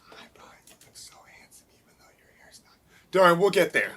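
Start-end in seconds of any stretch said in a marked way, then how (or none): 0.1-2.7 breathless whisper, rushed at the end. Shown as a demonstration. Teacher whispers the same lines to the class. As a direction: whisper it like a secret.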